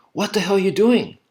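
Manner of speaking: coach's frustrated shout, lightly replayed; retold corner yell. Corner yelling at his fighter, mild mock yell. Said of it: "they're yelling at me and going".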